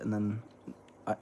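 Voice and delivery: usual speaking voice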